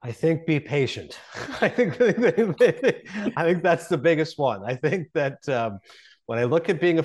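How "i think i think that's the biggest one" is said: laughing through the words. Real, not acted. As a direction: chuckle through the answer. Laughing while answering.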